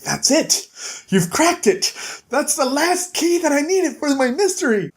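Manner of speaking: high, triumphant realization; light up as the mystery cracks; excited eureka. Retold or imitated. Bigby tells Snow he solved it, high excitement.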